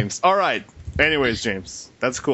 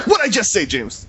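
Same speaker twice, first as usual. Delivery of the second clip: high-pitched pretend exasperation; mock scolding; 10 semitones higher than usual. Host pretending to scold James for not moving on, high mock annoyance. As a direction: scold him, jokingly, pitch jumping up.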